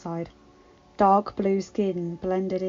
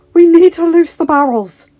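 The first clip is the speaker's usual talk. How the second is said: high, very loud plea; urgent, full-voiced battlefield plea; almost pleading shout; 8 semitones higher, 14 dB louder than usual. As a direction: shout it as a desperate appeal. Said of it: "The last was almost a plea".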